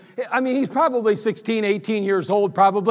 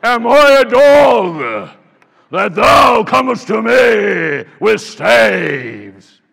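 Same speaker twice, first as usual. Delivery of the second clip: very slow, much louder giant's shout; Goliath's booming voice; 9 dB louder, much slower than usual. Pretend. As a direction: roar each word out like a four-hundred-pound giant. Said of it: "can you imagine the voice of Goliath?".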